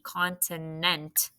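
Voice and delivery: slow, stretched-out word; teacher repeating the word slowly; slow demo word. Shown as a demonstration. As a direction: say it slowly, clearly, syllable by syllable. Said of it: "It's N T".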